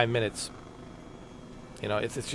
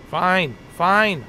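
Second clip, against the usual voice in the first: louder fine, clipped and higher; exasperated; 6 semitones higher, 5 dB louder than usual. Real, not acted. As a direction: give in with annoyance. Exasperated giving in.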